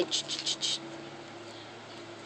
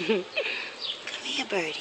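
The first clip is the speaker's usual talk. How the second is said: coaxing a wild bird; 6 dB quieter, faster than usual. Real, not acted. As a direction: gently coax the bird closer. Calling the bird softly.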